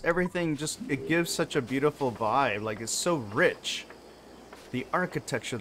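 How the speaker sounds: ordinary talk, nothing marked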